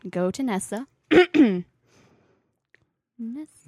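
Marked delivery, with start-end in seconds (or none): 1.1-1.6 loud, harsh throat clear. Acted. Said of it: "that hurt my ears".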